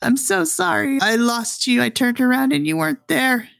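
high-pitched acted tearful boy; crying child. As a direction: apologise in a high, upset little-boy voice. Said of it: "as he begins crying again".

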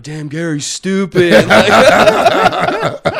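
loud, high laughing imitation; kids laughing. Classmates cracking up at his cap, loud laughter. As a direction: burst out squealing with laughter. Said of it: "started laughing like".

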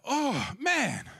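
surprised reaction after the cash booth; amazed. Retold or imitated. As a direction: marvel at what just happened. After the money frenzy.

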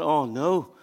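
soft, low, mock-worried aside; hushed mock dismay. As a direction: say it under your breath as if dreading the guest. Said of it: "you'd be thinking".

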